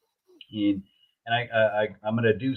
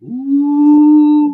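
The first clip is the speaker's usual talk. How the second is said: high, loud delighted ooh; excited ooh; 15 dB louder than usual. Real, not acted. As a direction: gasp in delight at the object. Admiring the new design.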